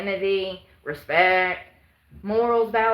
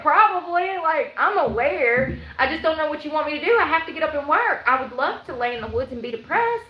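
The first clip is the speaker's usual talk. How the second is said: louder, higher fed-up reply; exasperated retort; 6 semitones higher, 4 dB louder than usual. Retold or imitated. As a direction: vent it with exasperation. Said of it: "I was like".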